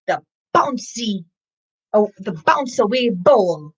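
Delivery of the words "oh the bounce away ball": ordinary delivery, nothing marked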